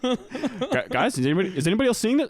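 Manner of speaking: mock alarm. Golfer asking the boys what they saw, mock alarm. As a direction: play a freaked-out golfer checking with his buddies.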